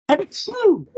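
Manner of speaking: loud real sneeze; big sudden sneeze. Real, not acted. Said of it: "So I'm gonna sneeze maybe".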